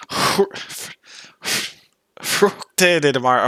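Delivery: breath out "Fru-, fr-" repeatedly, then slow "fructe de mare"; attempted Romanian onset. Shown as a demonstration. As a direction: practise the accented start of "fructe de mare", drawn out. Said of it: "it sounds really deep".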